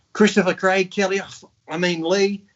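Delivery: put-on grandma calling the wrong kids' names; imitating grandmother. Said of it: "she'd go".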